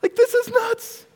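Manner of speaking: high, loud, thrilled burst; excited. Retold or imitated. Forgiven debtor telling everybody the news, thrilled and loud. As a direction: gush like you just got away free. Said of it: "Excited to tell everybody".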